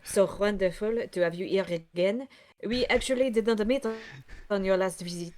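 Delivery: put-on French accent, hesitant welcome; silly French character voice. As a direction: greet a guest in hammy French-accented English. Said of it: "this stupid French voice".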